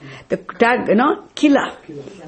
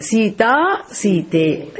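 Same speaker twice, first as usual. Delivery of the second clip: ordinary talk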